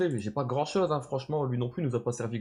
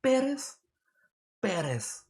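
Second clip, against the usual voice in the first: put-on English accent; 8 semitones higher, slower than usual. Streamer to chat, announcing the rapper: put-on English accent. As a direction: hype the artist's name high in mock English. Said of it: "j'ai trouvé la tonalité de [name]".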